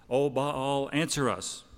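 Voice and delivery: loud, high cry to a god; calling out. Retold or imitated. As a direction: call up to the heavens. Prophets calling on their god.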